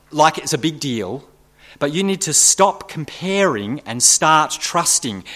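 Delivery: ordinary talk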